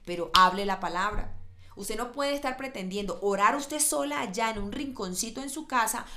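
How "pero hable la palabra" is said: louder, forceful command to speak the word; fervent preaching punch; loud exhortation. Real, not acted. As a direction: drive the line home.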